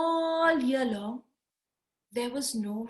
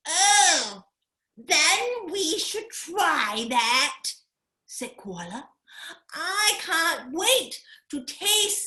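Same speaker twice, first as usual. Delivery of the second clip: eager koala; 5 semitones higher, 3 dB louder, slower than usual. Acted.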